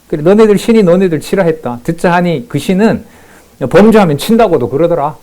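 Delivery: high, loud sneering jeer at the defeated; mocking taunt. Preacher voicing Rabshakeh mocking Judah's faith, loud high sneer. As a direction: taunt them as the arrogant envoy. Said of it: "희롱입니다".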